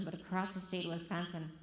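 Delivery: ordinary talk, nothing marked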